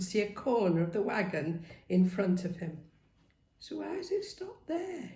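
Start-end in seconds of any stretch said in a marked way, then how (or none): 3.6-5.1 higher, softer puzzled wondering. Acted. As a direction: muse quietly to himself. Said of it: "he wondered".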